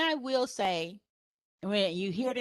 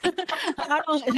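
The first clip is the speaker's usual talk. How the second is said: ordinary delivery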